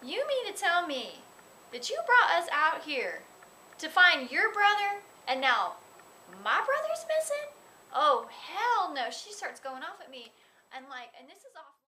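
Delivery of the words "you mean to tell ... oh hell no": high, rising indignant rant, building to the end; mock outrage. Co-star going off at me on set, outraged and loud. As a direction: act out her going off at me, pitch climbing. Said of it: "she goes way off her lines".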